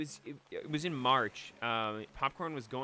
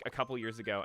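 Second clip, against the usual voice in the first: usual speaking voice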